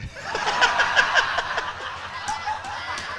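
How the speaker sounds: a long, high, breathy burst of laughter. Real, not acted. Speaker laughing at a joke with the audience, high burst of laughter.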